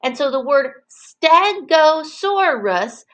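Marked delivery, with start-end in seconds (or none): 1.2-3.0 "stegosaurus" slowly in four distinct beats; higher, deliberate "stegosaurus", each syllable parted. Shown as a demonstration. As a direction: mark out every syllable of "stegosaurus". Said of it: "has four syllables".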